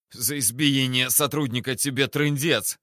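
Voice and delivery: usual speaking voice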